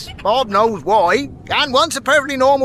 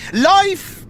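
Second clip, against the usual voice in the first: a high, emotional first "Life,"; "Life," lifted high, held back before the tears; choked-up farewell; 7 semitones higher than usual. Acted. Farewell to departing old friend.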